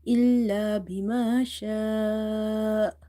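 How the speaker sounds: إلا بما شاء with a long "aa" in شاء cut off suddenly; stretch, sudden stop. Shown as a demonstration. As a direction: slowly hold شاء, then stop dead on the hamza. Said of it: "you give it a sudden pause".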